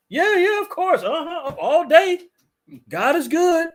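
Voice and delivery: very high, gushing churchgoer imitation; mock eager agreement. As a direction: parody an over-keen believer saying yes. Mimics eager churchgoers answering him.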